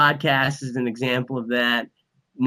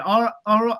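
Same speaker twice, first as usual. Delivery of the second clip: higher, hesitant stab at Australian; attempted Australian accent; 7 semitones higher than usual. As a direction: try out a rough Australian accent. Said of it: "I'm trying to do an Australian accent".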